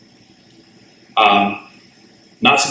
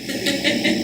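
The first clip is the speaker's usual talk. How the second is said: short laugh; 6 dB quieter than usual. Real, not acted. Laughs at his own remark.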